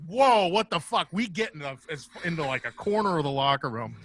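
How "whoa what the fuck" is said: alarmed whoa. Retold or imitated. Seeing the unsheathed sword.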